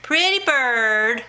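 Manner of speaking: drawn-out singsong bird call; slow pet-bird voice; talking to a parrot. Real, not acted. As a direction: coo it slowly to the bird.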